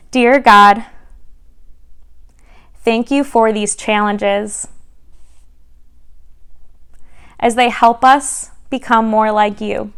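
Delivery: prayer. Real, not acted. Leads children in prayer.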